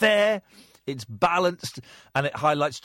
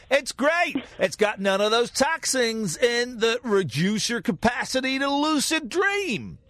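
big, excited announcer pitching a product; advert voice; 8 semitones higher, slower than usual. Pretend. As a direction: sell it like a cheesy TV ad. Mock toothpaste advert read.

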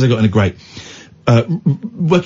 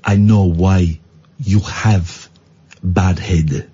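put-on lightly done Polish builder's accent, lower; a hint of a Polish accent, dropping the articles; 8 semitones lower, much slower than usual. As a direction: imitate the Polish builder, low and deadpan. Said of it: "I won't do the accent, but it's Polish".

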